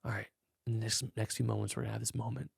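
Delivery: soft, quick hushed voice; dreamy voice. Shown as a demonstration. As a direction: lull them gently into the moment. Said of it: "I just naturally start".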